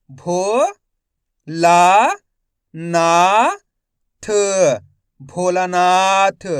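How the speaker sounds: slow spelling drill, each syllable held with a rising end; stretched syllables for beginning readers; letter-by-letter sounding out. Shown as a demonstration. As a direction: sound out each letter, then blend the word. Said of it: "एक अक्षर का उच्चारण करके".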